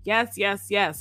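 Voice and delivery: ordinary talk, nothing marked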